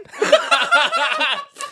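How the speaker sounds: high cackling villain laugh; evil laugh. Retold or imitated. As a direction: cackle like a sorcerer. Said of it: "Here's my Shang Tsung impression".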